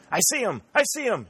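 high-pitched, urgent cry of discovery; sudden high cry to comrades; excited shout. A narrator's reading. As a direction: shout it out, pitch leaping far up. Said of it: "he shouted".

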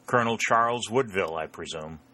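ordinary delivery, nothing marked

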